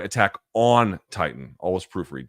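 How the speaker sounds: normal talking voice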